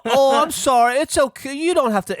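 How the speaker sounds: high-pitched flustered apology, hammed up; loud, very high imitation of apologetic strangers; mock British bystanders. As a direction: caricature awkward bystanders hurrying to apologise. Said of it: "Then the British people go".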